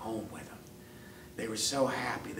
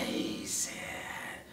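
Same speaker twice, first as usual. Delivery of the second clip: ordinary delivery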